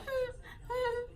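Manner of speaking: tiny, high, soft whimpers; faint high-pitched pretend fussing; imitated baby whimper. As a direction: whimper very softly like a settling baby. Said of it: "then she's like".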